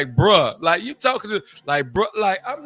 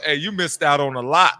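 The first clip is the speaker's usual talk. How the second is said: normal talking voice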